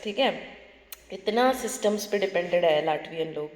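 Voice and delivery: normal talking voice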